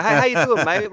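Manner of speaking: a hint of London geezer; mock London accent. Host greets listener in a mock London accent. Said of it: "That Battlefield".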